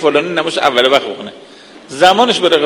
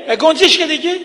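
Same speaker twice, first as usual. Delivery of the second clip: much higher, irritated mock yell; retold exasperated yell; 11 semitones higher than usual. Son fed up with his father's repeated question, mock exasperated yell. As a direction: imitate a son losing patience, pitch shooting up. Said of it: "پسر داد زد".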